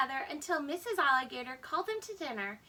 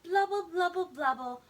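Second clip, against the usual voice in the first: wet lip-burbled bubbling, louder; bubble noises; 2 semitones higher, 5 dB louder than usual. Acted. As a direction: blow the words like milk bubbles. Bubbling milk sound effect.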